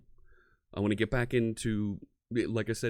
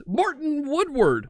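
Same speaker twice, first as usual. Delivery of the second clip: excited greeting; 5 dB louder than usual. Real, not acted. Calling out to a friend spotted in the chat, excited.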